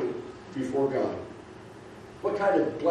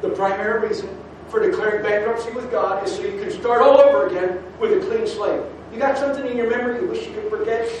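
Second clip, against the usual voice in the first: higher, louder sermon build; rising preaching; 5 semitones higher, 3 dB louder than usual. Real, not acted. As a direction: drive the promise home.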